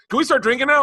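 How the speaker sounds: high, loud, eager imitation; hammed-up keen question; eager request. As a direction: ask it high and keen, imitating an excited friend. Acts out the other comic's eager question.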